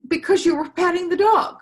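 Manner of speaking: jealous daughter answering her mother, high and loud; little girl. Acted. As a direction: whine it up high like a hurt little kid. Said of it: "And she said".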